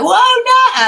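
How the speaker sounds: sudden high shout of alarm; high, loud panicked "whoa, no"; alarmed cry. Retold or imitated. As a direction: yelp it as the bowl goes over. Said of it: "And I'm like".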